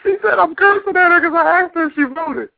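high, laugh-filled retelling; laughing while talking. Real, not acted. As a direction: talk through laughter.

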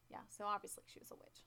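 softly whispered sarcasm; sarcastic whisper. Acted. Cohost mocking the town's witch logic in a whisper. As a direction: whisper it with mock conviction.

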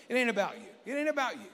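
firm rising declaration; telling a neighbour. Shown as a demonstration. Pastor has the congregation tell their neighbour, building declaration. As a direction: say it to the person beside you, building. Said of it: "you just tell them".

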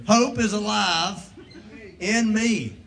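"Hope" belted out loud; loud preaching. Shown as a demonstration. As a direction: proclaim it to the back row. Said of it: "Say it with me".

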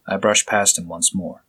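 plain reading voice

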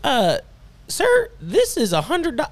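high, bright attendant voice; ticket attendant. Acted. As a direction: play a chirpy ticket seller.

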